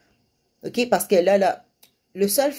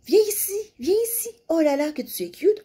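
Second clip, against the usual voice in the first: put-on much higher, cooing voice to a toddler; sing-song parentese; 10 semitones higher than usual. Shown as a demonstration. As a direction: coo at the child like a doting parent. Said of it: "tu peux dire à ton enfant".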